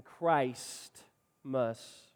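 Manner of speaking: Christ drawn out, pause, then must; stress on Christ. Shown as a demonstration. Preacher showing how people say Christmas, stress on Christ. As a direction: set Christ apart from the word's ending. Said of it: "people are emphasizing".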